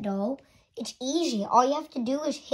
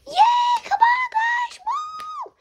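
excited cheer; much slower than usual. Acted. Guests cheer for piñata game.

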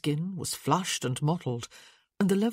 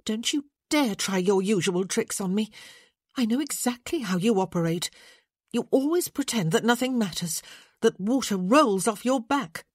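scolding; 5 semitones higher, 3 dB louder than usual. Acted. Scolding the sick man.

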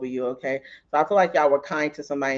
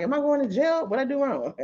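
higher, hammed-up alarm; mock panic; 9 semitones higher than usual. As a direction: clutch your heart and fret. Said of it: "I grabbed my heart".

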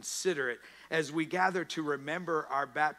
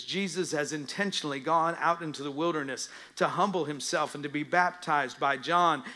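normal talking voice

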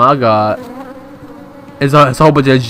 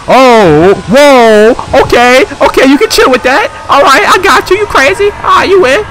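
high, loud, rattled reaction; alarmed; 9 semitones higher, 5 dB louder than usual. Real, not acted. Player confronted in a horror game, high alarmed bluster.

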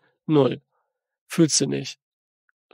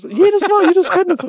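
very high, loud incredulous outburst; loud exasperation; 14 semitones higher, 8 dB louder than usual. Real, not acted. Critic rants about endless photo finishes, loudly exasperated. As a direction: cry it out high and loud in disbelief.

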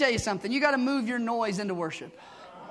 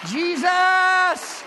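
a loud, higher, ringing call of the name; call him by name; 8 semitones higher, 9 dB louder than usual. Shown as a demonstration. Preacher showing the church how to call Jesus, ringing shout. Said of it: "you call him by name".

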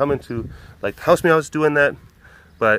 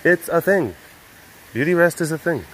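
sassy pet voice; 6 semitones higher than usual. Retold or imitated. The pet defending her nap, smug and sassy. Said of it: "She's like".

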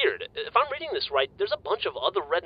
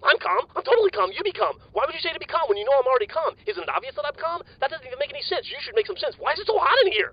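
much higher, fast and jittery denial; way too hyped to sit still; wired, insisting he's calm; 12 semitones higher, 3 dB louder than usual. Acted. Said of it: "it's sort of malfunctioning right now".

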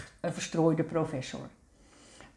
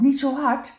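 usual speaking voice

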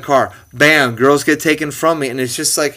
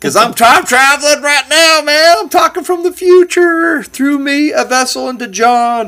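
put-on Southern accent; 13 semitones higher, 4 dB louder than usual. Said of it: "suddenly picked up a Southern accent there".

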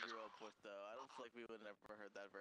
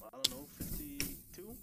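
high, loud and slow guess; unsure guess; 12 semitones higher, 10 dB louder than usual. Real, not acted. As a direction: stretch out a clueless answer.

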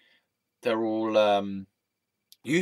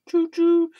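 mock train whistle, higher; train noise; 7 dB louder than usual. Joking about losing a train of thought, playful choo choo. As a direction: toot like a toy train. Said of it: "train of thought".

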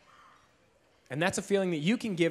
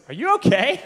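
higher, louder call from the next room; brother calling out; 7 semitones higher, 9 dB louder than usual. Retold or imitated. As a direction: call through the wall to check on him.

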